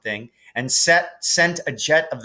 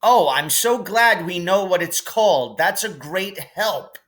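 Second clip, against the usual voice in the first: put-on higher sarcastic boy; mock snarl of sarcasm; lightly voiced sarcastic Ron; 8 semitones higher than usual. A narrator's reading. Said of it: "snarled Ron".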